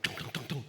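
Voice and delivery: low, soft knocking noises; imitated door knock. Roommate knocking at a bedroom door at night, imitated knocking. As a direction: mimic muffled thumps on a door. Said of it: "I hear a knock on the door".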